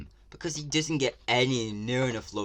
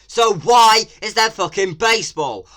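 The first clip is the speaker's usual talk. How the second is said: much louder and higher, furious disbelief; outraged shout; 12 semitones higher, 14 dB louder than usual. Real, not acted. Vlogger yelling again about baseball in the game. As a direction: scream the question at the screen. Ranting at the trailer.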